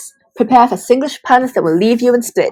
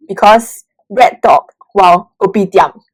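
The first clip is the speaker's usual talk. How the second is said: Singlish. Pretend. Singlish punchline.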